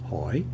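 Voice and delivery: hỏi dipping low then rising; questioning tone. Shown as a demonstration. Teacher demonstrating the tone when pronounced, questioning rise. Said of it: "it sounds like you're asking a question".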